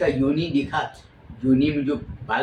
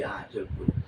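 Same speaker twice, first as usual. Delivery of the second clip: hushed whisper; 8 semitones lower, 8 dB quieter than usual. Acted. Bhabhi coaxing devar to speak.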